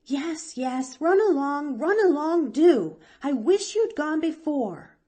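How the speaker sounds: high, loud hurried shooing; imitating impatient aunt. Girl mimicking her aunt shooing her away, high and loud. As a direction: rush her out the door. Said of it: "she said".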